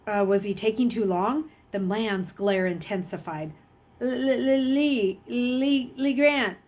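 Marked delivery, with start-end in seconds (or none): 4.0-6.6 stammering, higher and halting; nervous stammer. A narrator's reading. Scared new boy stammering his false name to teacher. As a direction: stumble over the new name in panic. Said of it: "Luke finally stammered".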